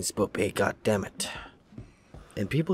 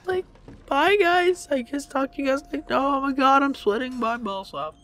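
very high, loud, frantic pleading; panicked screaming; 11 dB louder than usual. Real, not acted. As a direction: scream it as if desperate to escape. Panicked, begs to end the video.

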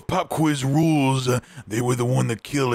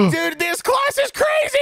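high shout. Acted. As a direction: yell it out in a panic. Fleeing wild class.

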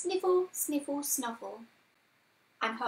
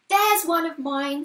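put-on high, much louder child character; excited exclamation; 5 semitones higher, 11 dB louder than usual. A narrator's reading. Lucy spotting one of hers, excited exclamation. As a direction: call out loudly on spotting your own. Lucy spots her own handkerchief.

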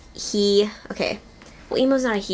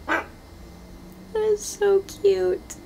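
cooing; 9 semitones higher than usual. Real, not acted. Cooing at the robot.

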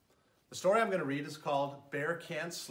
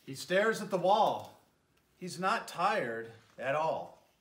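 gentle, lilting read-aloud voice; storybook narration; 4 dB louder, slower than usual. A narrator's reading. Mayor reading a bedtime story to children, lilting narration. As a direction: tell it like a bedtime story for little ones. Reads picture book to children.

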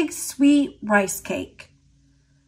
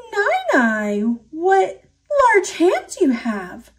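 put-on much higher sweet girl voice; little girl; 7 semitones higher than usual. A narrator's reading. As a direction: chirp it like a small girl. Girl questions grandma.

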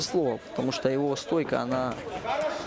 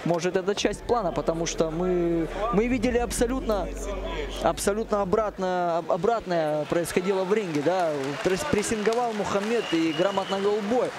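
excited; 6 semitones higher than usual. Real, not acted. Commentator still stunned after knockout.

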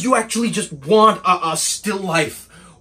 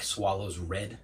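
very low, hushed delivery with long pauses; quiet resignation; 12 semitones lower, 18 dB quieter than usual. Acted. Assistant giving up on his boss, quietly resigned. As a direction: give up on him softly.

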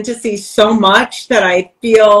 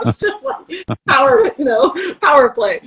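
ordinary talk, nothing marked

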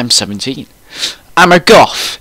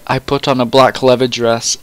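ordinary delivery

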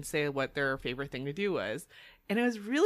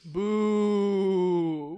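ghost boo; 4 dB louder than usual. Pretend. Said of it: "That is Ashley's ghost".

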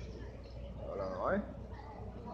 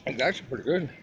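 eating popcorn while talking; crunching chips; 6 semitones lower than usual. Real, not acted. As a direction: talk through crunchy popcorn. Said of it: "the popcorn".

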